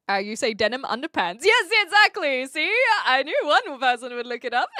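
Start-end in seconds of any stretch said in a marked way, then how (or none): 1.4-2.7 high, loud delighted "yes, exactly"; thrilled that chat got it right; excited. Real, not acted. Confirming a chatter's correct guess, excited and loud. 3.0-4.7 said through a laugh; amused, laughing while talking. Real, not acted. Teasing the chatter who looked it up, laughing.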